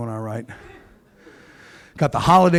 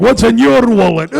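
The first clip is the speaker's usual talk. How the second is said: booming ad announcer voice; commercial voiceover; 7 semitones higher, 8 dB louder than usual. Retold or imitated. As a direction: deliver it like a TV ad. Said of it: "like those old Capital One commercials".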